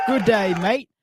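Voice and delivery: put-on light stab at an English accent greeting a mate; half-hearted English accent, cheery hello; attempted English accent. Host greets viewer Aaron in a faintly put-on English accent. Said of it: "I'm trying accents".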